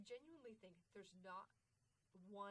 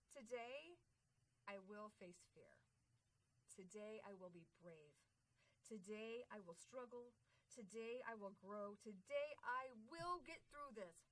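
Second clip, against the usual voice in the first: mantra recitation; 4 dB louder than usual. Pretend. Leading the audience in the daily mantra, building declaration. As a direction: recite it line by line, pausing, rising to a big finish. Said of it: "So here's the mantra".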